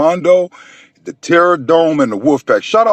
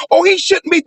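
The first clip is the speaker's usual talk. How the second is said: mocking quote; 8 semitones higher than usual. Mocking a hypocritical giver.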